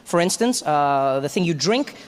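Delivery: usual speaking voice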